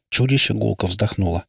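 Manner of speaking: usual speaking voice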